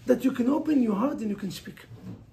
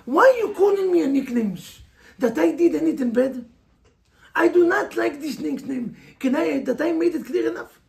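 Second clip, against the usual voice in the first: higher, louder firm challenge to a name-caller; firm confrontation; 3 semitones higher, 4 dB louder than usual. Shown as a demonstration. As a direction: stand up to the name-caller, firmly, not rudely. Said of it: "Speak what you have in your heart".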